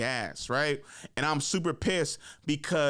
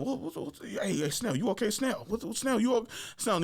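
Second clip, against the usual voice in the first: higher, stammering alarmed questions; imitated concerned coach; 7 semitones higher than usual. Coach trying to calm his cursing player, flustered. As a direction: play a flustered coach checking on someone.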